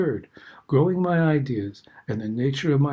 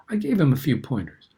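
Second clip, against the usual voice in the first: usual speaking voice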